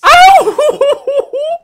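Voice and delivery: high, wavering "ohhh" wail; long quavering howl after a fright; frightened wail. Real, not acted. Streamer reeling from a scare, high wavering wail.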